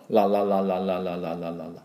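ra-ra-ra to show a clear L; tongue-tip L practice; L drill. Shown as a demonstration. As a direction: repeat la la la with the tongue tip, fading away. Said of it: "Lの音が".

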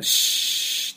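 シ as a long voiceless "shhh"; shushing SH. Shown as a demonstration. Teacher likening it to hushing noise, long shushing SH. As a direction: hush a noisy room with シ, no vowel. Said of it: "うるさい時のシって音ですね".

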